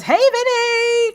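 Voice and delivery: high, slow sing-song hey; cheery greeting. Retold or imitated. Listener Grace greeting host in sing-song. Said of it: "who says".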